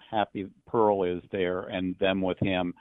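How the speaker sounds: usual speaking voice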